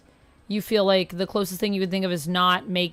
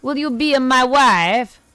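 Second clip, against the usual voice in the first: loud, higher Borat impression presenting his wife; hammed-up Borat accent, loud and high; 4 semitones higher, 9 dB louder than usual. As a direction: proudly introduce her like Borat. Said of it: "With the Borat voice".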